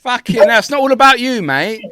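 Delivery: loud, exasperated protest; exasperated telling-off. Real, not acted. Mock telling-off of someone interrupting.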